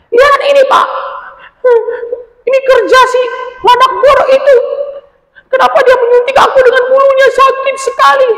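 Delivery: very high, loud threatening shout; furious squeaky creature yelling; angry threat. Acted. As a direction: threaten the fleeing porcupine. Anjani complains to father.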